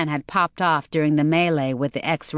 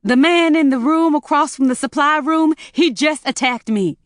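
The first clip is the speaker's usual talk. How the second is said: high, loud, frightened rush of words; a loud, high, panicked outburst; panicked and breathless; 9 semitones higher, 5 dB louder than usual. Acted. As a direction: blurt it out in a panic. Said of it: "almost hyperventilating".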